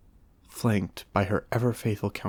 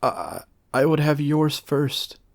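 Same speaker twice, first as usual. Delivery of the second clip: frightened stammer, higher and louder, slow; 6 semitones higher, 8 dB louder than usual. A narrator's reading. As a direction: stumble over the first word in fear. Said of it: "stammered Maga".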